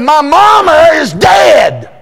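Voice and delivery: slow, high, loud retort; defiant shout. Retold or imitated. Preacher shouting his retort at the bossy woman. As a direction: hammer each word out slow. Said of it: "I said".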